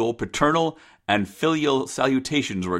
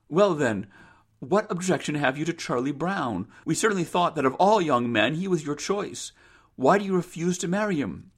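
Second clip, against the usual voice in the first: higher-pitched mother's voice; questioning mother; 6 semitones higher than usual. Acted. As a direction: press her gently for a reason.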